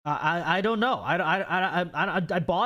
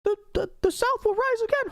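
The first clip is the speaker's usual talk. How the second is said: squeaky, much higher Mickey Mouse-like puppet voice; Dixie Steve voice. Acted. Streamer voices his python puppet answering chat, squeaky cartoon-mouse voice. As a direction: pipe it up high like a cartoon mouse, proud and defiant. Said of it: "he talks like Mickey Mouse".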